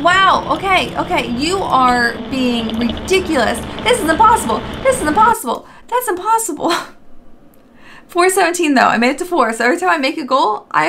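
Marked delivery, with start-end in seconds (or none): 3.8-6.7 exasperated whine. Real, not acted. Losing the game, exasperated.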